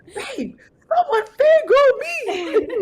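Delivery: high giddy squeal, drawn out; silly excitement. Retold or imitated. Author telling partner at home someone fangirled her, silly and giddy. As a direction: gush it slowly and high, breaking into a laugh. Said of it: "I was acting silly".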